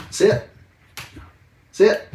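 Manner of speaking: loud, sharp sit command; firm dog commands. Real, not acted. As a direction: bark the command firmly.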